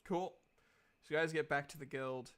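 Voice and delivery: ordinary talk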